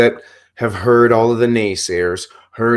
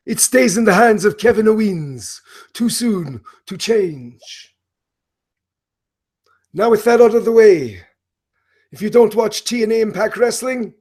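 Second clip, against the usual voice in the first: Broken Matt Hardy impression; 6 semitones higher than usual. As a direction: play the unhinged, grandiose wrestler announcing matches. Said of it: "the very complex character of Broken Matt Hardy".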